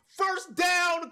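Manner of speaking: shouted first down. Real, not acted. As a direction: belt it out for the fans. First down call.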